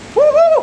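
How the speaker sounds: high, loud whoop; joyful whoop. Real, not acted. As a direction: cheer at the top of the voice. Celebrating the rain.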